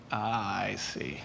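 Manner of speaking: soft, hushed "ah, I see"; hushed dawning understanding; quiet realization. Retold or imitated. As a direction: murmur it as the penny drops. Retold realization in class.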